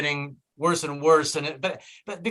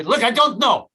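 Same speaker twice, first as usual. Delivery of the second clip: higher, louder put-on foreign voice; irritated foreign attendant; 7 semitones higher, 5 dB louder than usual. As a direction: cut the customer off in mock irritation. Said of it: "expect them to speak English".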